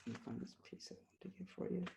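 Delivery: quick whisper to herself; whispered aside. Real, not acted. Poet looking for the next poem, quick whispered aside. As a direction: mutter while searching the page.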